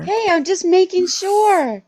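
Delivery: high, loud, drawn-out defensiveness; playful protest. Real, not acted. Co-host defending a London boy joke, loud playful protest.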